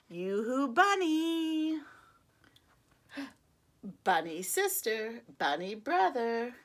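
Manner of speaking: usual speaking voice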